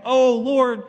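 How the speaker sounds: high, loud mock plea; hammed-up desperate prayer; pleading brothers. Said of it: "her brothers".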